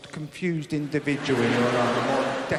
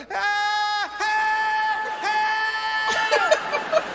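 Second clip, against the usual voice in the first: high panicked shriek, laughing; comic high-pitched panic at the door; panicked yelp; 8 dB louder than usual. Acted. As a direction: squeal for help in sudden panic.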